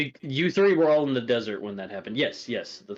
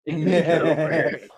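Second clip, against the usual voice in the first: scorpion voice; 3 semitones higher, 4 dB louder, slower than usual. Retold or imitated. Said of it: "doing the scorpion voice".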